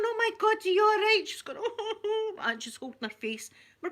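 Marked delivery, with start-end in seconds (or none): 0.0-1.2 high, loud retold panic; shocked concern. Me and my mum to the injured old lady, alarmed. 1.6-2.4 high wailed "oh" moans; mock crying. Old lady holding her face, mock crying.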